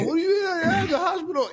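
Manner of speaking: very high, squealed panicky echo; hammed-up high-pitched alarm; mock freak-out. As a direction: squeal it like someone comically overreacting. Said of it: "I don't wanna freak anybody out".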